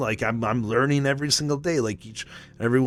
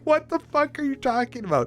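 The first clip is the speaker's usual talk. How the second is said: much higher, laughing disbelief; incredulous disbelief; 13 semitones higher than usual. Retold or imitated. Reacting to his cousin's husband's king story, high and laughing. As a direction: squeal it like you can't believe him.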